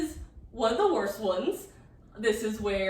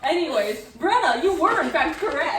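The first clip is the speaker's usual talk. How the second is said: laughing; 10 semitones higher, 9 dB louder than usual. Real, not acted. Laughing after joke.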